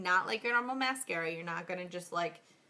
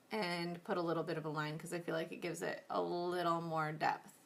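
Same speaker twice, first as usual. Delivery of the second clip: normal talking voice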